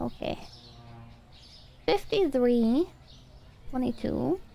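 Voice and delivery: ordinary talk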